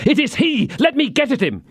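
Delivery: high, frantic and straining to go; passionate outburst. A narrator's reading. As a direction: cry out, desperate to chase him. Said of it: "pale with passion".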